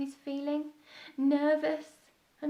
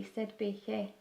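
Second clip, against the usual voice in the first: ordinary delivery, nothing marked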